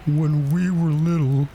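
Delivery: high, mournful reminiscing; weepy turtle, somewhat composed; tearful calm. A narrator's reading. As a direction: reminisce dolefully, near tears. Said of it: "though still sobbing a little now and then".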